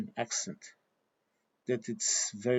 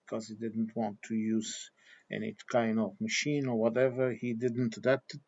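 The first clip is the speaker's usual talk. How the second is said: ordinary talk, nothing marked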